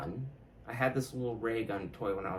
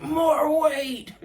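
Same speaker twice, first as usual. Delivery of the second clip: defiant roar, much higher and louder, breaking into a laugh; Giles Corey; 8 dB louder, slower than usual. Retold or imitated. Mocking his younger self, he hams up a roaring martyr's defiance. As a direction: bellow it like a martyr begging for more stones. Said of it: "I acted like Giles Corey".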